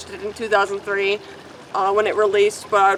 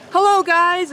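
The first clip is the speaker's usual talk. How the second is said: normal talking voice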